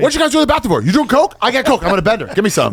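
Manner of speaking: high-pitched eager pestering; pushy bender guy. Retold or imitated. Bender guy pesters strangers for coke, comically eager.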